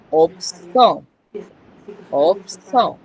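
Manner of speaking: opso with a closed P into a strong, tensed S; tense S. Shown as a demonstration. Teacher modelling the linked S, opso with tense S. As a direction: press the S hard in opso. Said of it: "va a sonar tensa".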